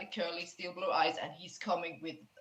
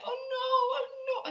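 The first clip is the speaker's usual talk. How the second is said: much higher, crying oh no; sobbing girl. Acted. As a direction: wail it through tears. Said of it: "and she sobs".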